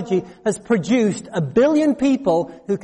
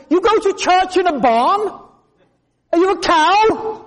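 higher-pitched, incredulous questioning; mock disbelief, voice lifted high; baffled priest; 8 semitones higher than usual. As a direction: ask it as if it cannot compute. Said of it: "it was like it didn't compute".

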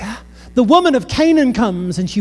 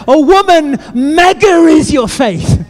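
much louder and higher, slow and emphatic; booming praise; 7 semitones higher, 6 dB louder, slower than usual. Retold or imitated. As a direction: proclaim it to the whole crowd. Jesus quote redone with mega.

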